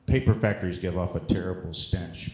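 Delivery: ordinary talk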